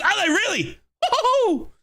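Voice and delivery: very high cheer with a laugh; delighted cheer. Real, not acted. Fan cheering the rival's defeat, high whoop. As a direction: whoop for joy.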